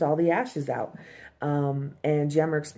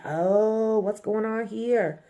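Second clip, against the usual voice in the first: teasing ooh; 6 semitones higher, slower than usual. Retold or imitated. Coworkers teasing about private talk.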